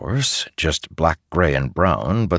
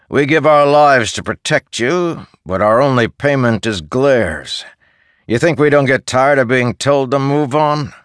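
much higher, louder, resentful soldier; a guard sick of thankless work; aggrieved soldier; 9 semitones higher, 5 dB louder than usual. Acted. Sunken-eyed soldier complains to the boy about glares, aggrieved.

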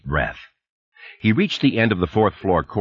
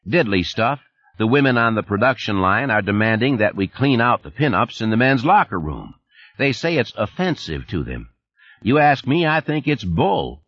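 higher, folksy Texas twang; a chummy Texan supervisor on the phone; 4 semitones higher than usual. Acted. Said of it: "the familiar voice with the Texas twang".

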